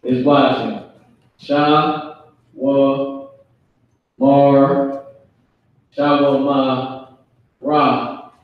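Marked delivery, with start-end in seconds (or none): 1.4-8.3 Sha, wa, mar broken into slow separate syllables. Shown as a demonstration. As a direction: sound out each syllable for the class. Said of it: "There's a ra now connects to that ha".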